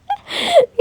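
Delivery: a sudden high-pitched laughing squeal; high squeal of laughter. Real, not acted. Vlogger spotting the elephant mascot, a high squealing laugh. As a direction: burst into a high, giggling squeal.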